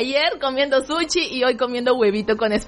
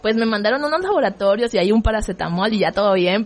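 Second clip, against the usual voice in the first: normal talking voice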